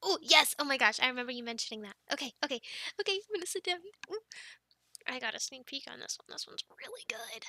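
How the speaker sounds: normal talking voice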